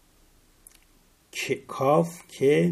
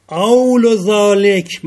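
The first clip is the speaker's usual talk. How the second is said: drawn out, much louder and higher; slow emphatic reading; 8 semitones higher, 12 dB louder, much slower than usual. Shown as a demonstration. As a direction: read each word out slowly and loudly, like reciting a text. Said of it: "من اینجوری میخونم".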